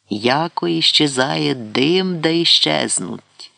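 slow, drawn-out church-style verse; solemn recitation. A narrator's reading. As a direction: intone it slowly like a prayer. Dean solemnly recites a prayer verse. (урочисто й протяжно, як молитву)

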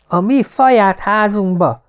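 put-on high, slow lisping child voice; a proud little kid answering, lisping. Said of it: "szempillantás alatt felhagyván az okogással".

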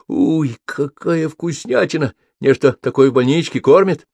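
put-on high, amazed little boy; delighted child. A narrator's reading. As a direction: marvel at a new treat. Boy tastes ice cream first time. (восторженный детский голос)

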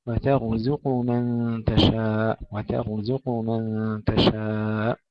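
"وترزق" slow, light taa, heavy raa, light zaay, heavy qaaf; tajweed demo. Shown as a demonstration. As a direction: draw out "وترزق من تشاء". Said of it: "ترقيق التاء وتفخيم الراء".

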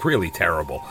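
usual speaking voice

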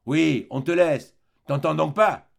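plus fort et lent, rudoyant; le gendarme rabroue le prisonnier; gendarme brusque. Acted. Un gendarme rudoie Valjean. (gendarme bourru, voix forte)

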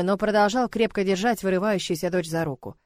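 usual speaking voice

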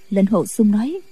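ordinary delivery

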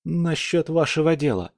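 put-on high nervous young man voice; anxious youth blurting it out; нервный юноша. Испуганный Кирилл. (взволнованный высокий голос юноши)

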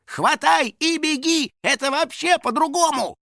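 high, panicked yelling; frantic protest. A narrator's reading. House spirit correcting the boy's fatal mistake, frantic. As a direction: squeal instructions in dismay. Horrified Finik keeps yelling.

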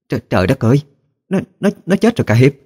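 high, stammering alarm; horrified exclamation. Acted. Old woman sees the boy is dead, horrified cry. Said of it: "Bỗng cụ thất kinh kêu lên".

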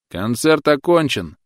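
slow, loud announcement; announcing. A narrator's reading. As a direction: call it out to the crowd. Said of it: "объявил Клод".

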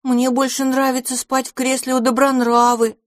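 put-on high, eager little child voice; little boy voice. A narrator's reading. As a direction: speak like a small pleading boy.

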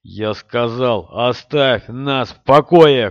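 slow, loud furious shout; angry shout. Acted. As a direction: roar it while striking. Rand swings fist, shouting.

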